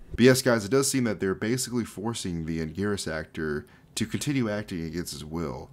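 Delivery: ordinary talk, nothing marked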